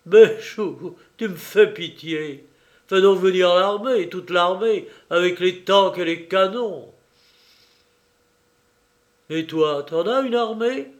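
loud, scornful jeering; jeering, defiant taunt; sneering taunt. Acted. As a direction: sneer at him through the weakness. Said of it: "Il ricana".

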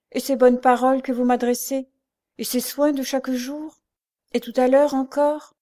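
earnest protest. A narrator's reading. Blind girl countering Pierre's self-blame, earnest protest. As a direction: list the kindnesses with rising fervour. Louise protests, listing his kindnesses.

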